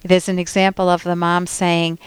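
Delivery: normal talking voice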